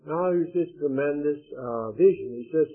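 usual speaking voice

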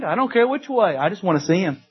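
ordinary delivery

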